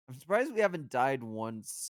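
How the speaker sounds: ordinary talk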